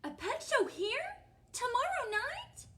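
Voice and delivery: high, delighted questioning; excited witch. Acted. Witch discovers the pet show flyer, excited. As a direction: pitch it way up in eager surprise.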